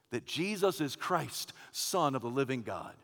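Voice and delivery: quiet, reverent confession; hushed declaration. Acted. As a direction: bring it down to a reverent hush. Quiet close after the climax.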